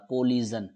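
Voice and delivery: कोलिजन with a buzzing z in the middle: ko-li-zun; z sound. Shown as a demonstration. Teacher demonstrating the word to students, z sound in कोलिजन. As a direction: give कोलिजन a clear z, not sh. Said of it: "अब मैंने यहाँ पे ज बोला".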